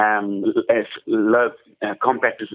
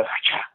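soft breathy "hacha" burst; quiet mimicked "hacha"; imitating mate's odd exclamation; 5 dB quieter than usual. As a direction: do Gary's quirky half-laugh noise. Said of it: "he'd just go".

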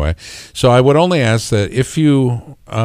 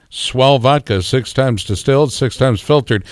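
upbeat announcer commercial voice; ad read; 4 semitones higher than usual. Acted. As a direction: sell it like a radio spot. Mock vodka commercial read.

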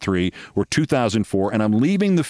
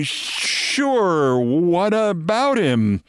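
drawn-out, higher, amused "sure"; amused reply; 5 semitones higher, much slower than usual. Retold or imitated. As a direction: stretch it out as if through a retold laugh. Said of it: "I laugh and I say".

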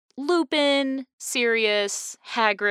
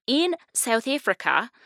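attempted South African accent, higher pitched; 5 semitones higher than usual. Shown as a demonstration. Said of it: "attempt at a South African accent".

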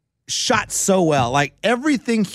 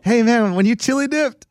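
high-pitched pretend interviewer; mock tactless question; 9 semitones higher than usual. Golfer mocking the question he never asked, put-on voice. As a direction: put on a higher, pestering voice for a silly question.

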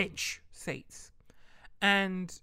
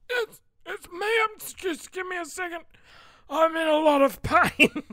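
much higher, strained tearful voice; pained whimper; 11 semitones higher, 10 dB louder than usual. Retold or imitated. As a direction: play up the pain, near tears. In pain answering the phone.

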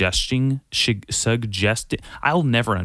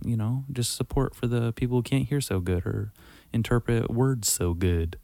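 ordinary talk, nothing marked

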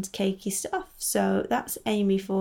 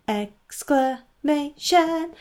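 much higher, slow, stretched out with flourish; dramatic drawn-out announcement; 6 semitones higher, 4 dB louder, much slower than usual. Shown as a demonstration. Host to listeners, hamming up the segment name grandly. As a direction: make the title a grand theatrical reveal. Says the show title twice.

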